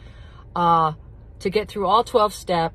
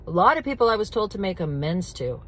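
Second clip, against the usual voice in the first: usual speaking voice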